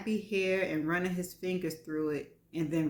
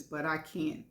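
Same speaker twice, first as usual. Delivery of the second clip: ordinary delivery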